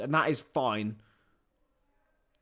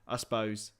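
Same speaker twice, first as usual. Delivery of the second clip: ordinary talk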